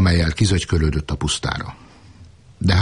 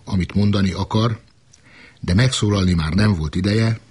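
ordinary delivery

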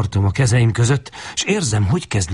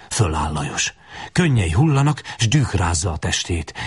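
usual speaking voice

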